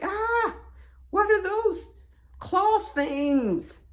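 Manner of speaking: high, startled yelp; retold shock, pitch shooting up; horrified. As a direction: recoil in acted-out alarm. Acted shock at own hands.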